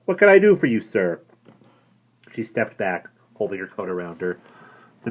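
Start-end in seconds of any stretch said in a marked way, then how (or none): 0.1-1.2 high, loud shopkeeper's voice; a jaunty dealer's greeting; voiced news dealer. A narrator's reading. News dealer greeting the woman, high and brisk.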